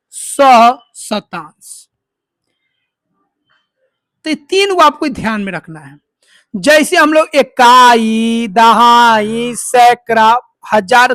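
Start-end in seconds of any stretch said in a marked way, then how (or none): 0.1-1.9 सहशतांश stretched very slowly; drawn-out term. Shown as a demonstration. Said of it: "इसको हम लोग बोलेंगे". 7.3-11.1 इकाई, दहाई, सैकड़ा stretched slowly one by one; slow listing. Shown as a demonstration. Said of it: "करते हैं ना".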